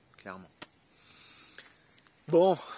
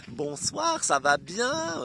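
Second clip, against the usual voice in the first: much higher, sugary greeting; sweet cashier voice; 11 semitones higher, 5 dB louder, much slower than usual. Retold or imitated. Imitating the suddenly friendly cashier, high and sugary. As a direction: mimic an overly sweet cashier. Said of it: "après, elle me fait".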